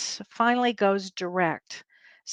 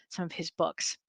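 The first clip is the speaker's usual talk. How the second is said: whispered aside; 4 semitones lower, 11 dB quieter than usual. Real, not acted. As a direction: trail off into a soft whisper.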